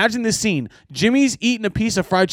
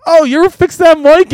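teasing question; 10 semitones higher, 6 dB louder than usual. Retold or imitated. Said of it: "And I was like".

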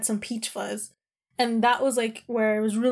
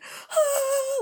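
long high-pitched mock wail; La Llorona wail. Shown as a demonstration. Showing viewers how hard she cried, long high wail. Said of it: "like La Llorona crying".

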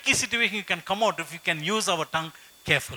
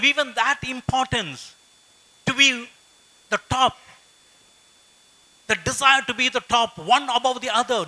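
higher and louder, pressing each point home; impassioned preaching; 6 semitones higher, 6 dB louder than usual. Acted. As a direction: preach it out to the congregation, slowing on key words. Preaching at full force.

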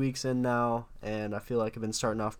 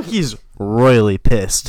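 loud, slow emphatic jab; mock outrage; 15 dB louder, much slower than usual. Real, not acted. Friend joking about the angry professor, loud and slow. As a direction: drag it out loudly.

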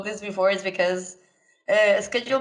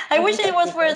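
laughing; 10 semitones higher, 5 dB louder, faster than usual. Real, not acted. Joking after laugh.

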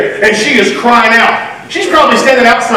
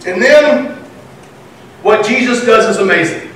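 normal talking voice

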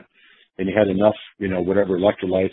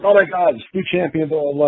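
crowd excitement; 7 semitones higher than usual. Retold or imitated. Crowd reacting to new champion.

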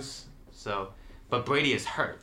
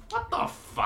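incredulous; slower than usual. Real, not acted. Disgusted reaction to the show.

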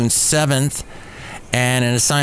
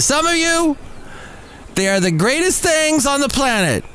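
much higher, mock-adoring sing-song; sarcastic gushing; 14 semitones higher than usual. Host mocking fans who adore the comedians, sarcastic high gush. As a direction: gush like a starstruck fan, clearly mocking.

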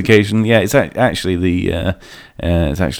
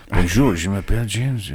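put-on French accent. Shown as a demonstration. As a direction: say it like a confident Frenchman. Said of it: "having the right accents".

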